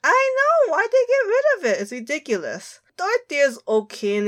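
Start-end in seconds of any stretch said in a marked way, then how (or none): 0.0-1.8 much higher, indignant and fast; outraged squeal. Real, not acted. Friend squeals indignantly about the character losing her hat. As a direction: squeal in protest at the lost hat.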